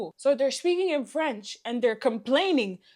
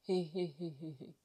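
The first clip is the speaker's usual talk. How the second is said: low, very soft string fading away; dismayed muttering; 8 semitones lower, 15 dB quieter than usual. Retold or imitated. Customer hearing who will do the rest, dismayed mutter. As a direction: let it die away under your breath.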